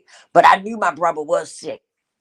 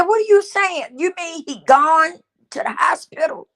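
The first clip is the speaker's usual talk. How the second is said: she can't take it in, voice shooting up; panicked disbelief; 9 semitones higher than usual. Retold or imitated. Panicked questioning of the nephew.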